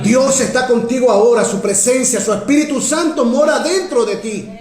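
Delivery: high, forceful pulpit declaration; fervent preaching. Real, not acted. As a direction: preach it with rising conviction.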